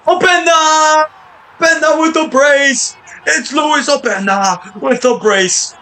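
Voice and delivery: loud, high, drawn-out goal call; goal shout. Real, not acted. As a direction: belt out the scorer's name. Second goal, commentator yelling.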